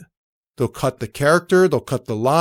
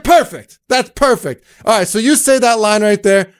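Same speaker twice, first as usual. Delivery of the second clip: much higher, louder praise; delighted crew; 6 semitones higher, 6 dB louder than usual. Retold or imitated. The crew praising his Italian, much higher and louder. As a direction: gush over the take. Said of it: "They're like".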